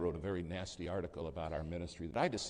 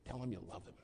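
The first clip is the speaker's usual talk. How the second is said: higher, softer urging; secretary's insistence; 5 semitones higher, 4 dB quieter than usual. Retold or imitated. Secretary urging the pastor on the phone, higher and softer. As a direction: press him gently but firmly. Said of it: "she'd go like this".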